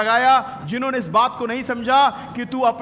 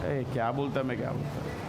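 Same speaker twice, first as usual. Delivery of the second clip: muttered aside; 10 semitones lower, 10 dB quieter, faster than usual. Real, not acted. Preacher confused by interpreter aside.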